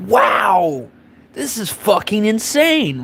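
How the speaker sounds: high, drawn-out, slowed amazement; slow, high-pitched mock astonishment; stunned disbelief. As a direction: let it hang, hardly believing what you see. Own stunned reaction retold.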